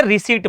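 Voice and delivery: रिसीट with silent p; no p before the t in रिसीट; right pronunciation. Shown as a demonstration. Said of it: "क्या बोलेंगे इसे". (रिसीट, 'प' चुप रखकर)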